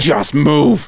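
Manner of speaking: low, controlled retold command; imitated no-nonsense teacher; stern order. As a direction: say it like a teacher staring down a kid. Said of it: "looked that kid dead in the eye".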